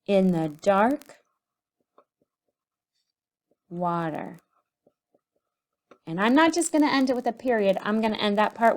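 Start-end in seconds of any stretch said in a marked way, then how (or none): 0.1-4.4 very slow, dark stretched; slow dictation. Shown as a demonstration. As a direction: linger on dark while writing. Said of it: "I'm going to say in the dark".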